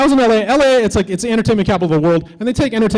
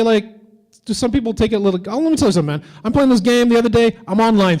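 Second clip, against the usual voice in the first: ordinary delivery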